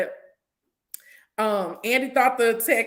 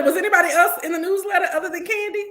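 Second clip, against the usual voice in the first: excited gossip; 5 semitones higher, 6 dB louder, faster than usual. Real, not acted.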